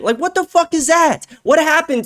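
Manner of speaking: jumps way up in pitch, disbelieving; can't believe what he just heard; incredulous outburst. Real, not acted. Ranting to viewers about Levin's praise, incredulous high outburst. Reacting to quoted praise of Trump.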